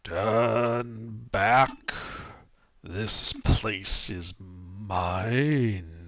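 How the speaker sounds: low, slow growling voice; demonic whisper. Acted. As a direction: growl it like a guardian beast. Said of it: "you hear a low, growling voice echo".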